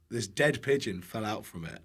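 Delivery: usual speaking voice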